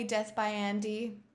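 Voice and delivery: normal talking voice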